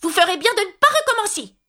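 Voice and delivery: high, indignant cried warning; cri de mise en garde. A narrator's reading. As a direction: cry out the warning at a high pitch. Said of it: "et elle s'écria". (avertissement crié d'une voix aiguë)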